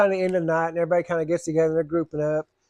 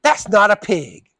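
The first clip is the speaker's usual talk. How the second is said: slow, louder, offended correction; indignant snap; 3 semitones higher, 7 dB louder, slower than usual. Retold or imitated. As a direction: put him in his place.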